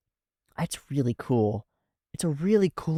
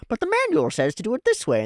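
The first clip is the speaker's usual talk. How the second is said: much higher, quick mock nerd voice; novice electrician; 10 semitones higher, faster than usual. Rookie electrician to veteran, smug mock whine. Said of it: "and goes".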